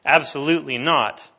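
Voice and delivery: emphatic refusal. Acted. Preacher answering Paul's question to the church, emphatic refusal. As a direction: throw out a forceful rebuttal.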